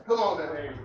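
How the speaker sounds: normal talking voice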